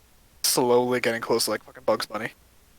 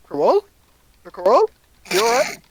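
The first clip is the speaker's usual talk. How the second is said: high, louder worried calls; calling out; 6 dB louder than usual. Acted. Magician calling to Carol about the noise. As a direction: call from the doorway. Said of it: "looks at it like".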